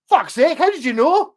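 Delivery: amazed, high and loud disbelief; surprised. Retold or imitated. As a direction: exclaim in loud astonished disbelief. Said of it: "And he was like".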